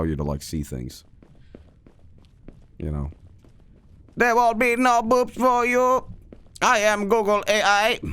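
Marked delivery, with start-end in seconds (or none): 4.2-8.0 high monotone robot voice, slow and even; Google AI robot. Acted. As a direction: drone it out like a high-pitched machine.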